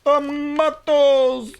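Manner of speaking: loud, high put-on TOMATOES; silly cry. Repeats it in sillier voice.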